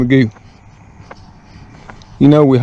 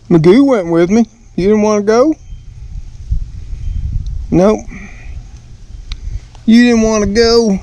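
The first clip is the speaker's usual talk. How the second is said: pet talk; 6 semitones higher than usual. Real, not acted. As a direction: coo at the cat like a doting owner. Talking to his cats.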